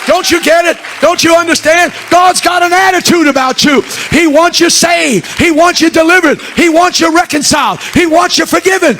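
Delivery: high, shouted pulpit climax; full-force preaching. Real, not acted. Preacher urging people that God wants them saved, full-force preaching. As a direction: hammer each line out loud and high.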